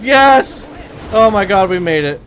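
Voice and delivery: high, loud relieved cheer; relieved laughter. Real, not acted. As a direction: burst out in relief. The jump succeeds.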